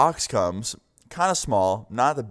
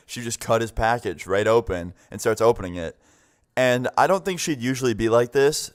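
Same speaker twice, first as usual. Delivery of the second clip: ordinary talk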